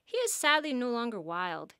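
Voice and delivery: plain reading voice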